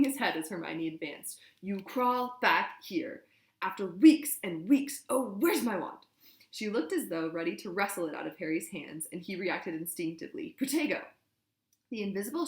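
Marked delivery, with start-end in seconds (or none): 1.6-6.0 slow, loud, raging accusation; furious shouting. A narrator's reading. Hermione attacking Ron. 10.6-11.0 sharp, high, loud incantation; urgent spell cry. A narrator's reading. Harry casts shield spell.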